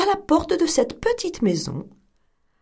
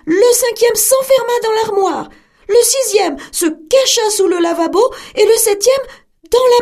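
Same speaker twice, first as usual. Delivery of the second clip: louder, higher hurried storytelling; excited narration; 9 semitones higher, 7 dB louder than usual. A narrator's reading. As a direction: tell the chase with rising alarm. Wolf attacks, kids hide.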